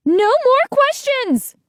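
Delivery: high, slow, booming shout. A narrator's reading. Missus Bernard storming in, commanding shout. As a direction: cut everyone off with a yell. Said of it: "a voice shouted".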